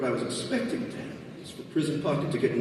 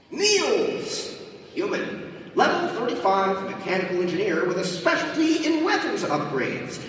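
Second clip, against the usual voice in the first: high, bright system announcer; game announcer voice; 9 semitones higher than usual. Acted. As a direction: announce it like a game show host.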